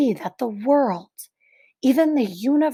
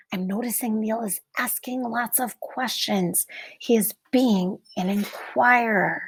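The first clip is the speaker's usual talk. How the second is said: put-on reading to children; 2 semitones higher than usual. Teacher reading a picture book to the class, hushed and wondering.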